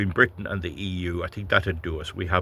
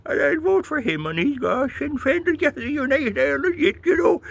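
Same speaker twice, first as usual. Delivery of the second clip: much higher, silly falsetto voter; mocking a gullible voter; 3 dB louder than usual. Mocking Irish voters choosing candidates, high silly falsetto. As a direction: ham up a dim voter gushing at the ballot. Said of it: "So they go in".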